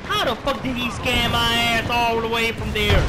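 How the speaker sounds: loud, indignant complaint; outraged disbelief. Real, not acted. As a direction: protest loudly as if cheated. Outraged at being spotted.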